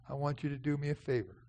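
ordinary delivery

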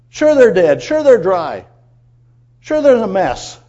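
loud, punchy repeated admissions; emphatic concession. Real, not acted. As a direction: hit each admission hard, starting high and falling. Emphatically conceding the bones' condition.

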